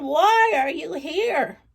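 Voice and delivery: put-on slow, changed old woman's voice; drawn-out question from a lightly voiced old woman. Old woman questioning the late visitor, slow put-on old voice.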